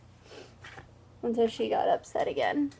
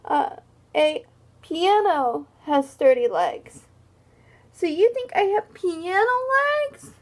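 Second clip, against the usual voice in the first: offended hippo; 8 semitones higher than usual. Acted. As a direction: sulk like a hurt hippo. Hippo's hurt protest voiced.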